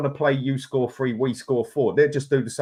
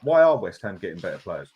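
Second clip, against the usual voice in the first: lower-pitched, deadpan sarcastic question; 7 semitones lower than usual. Real, not acted. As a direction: ask it drily, just to humour him. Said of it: "I'm just being ... sarcastic, mate".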